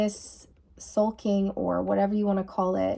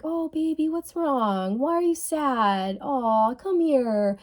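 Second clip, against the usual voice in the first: higher cooing mum voice; coddling caregiver; 6 semitones higher, slower than usual. Retold or imitated. Caregiver comforting a pouting child, higher cooing voice. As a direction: coo it like soothing a sulky child. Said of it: "mother or their caregiver would pay attention".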